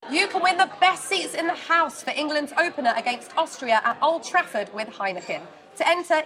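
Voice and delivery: upbeat sponsor ad read. Acted. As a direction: read it like a radio ad. Reads sponsor ad.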